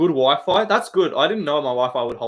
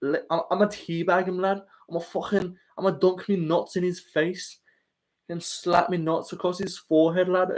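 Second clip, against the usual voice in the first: put-on light English accent, a touch higher; Paddy impression; 4 semitones higher than usual. Streamer answering chat as Paddy, light English accent. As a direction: swagger it like a cocky Paddy. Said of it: "It's the Paddy impression".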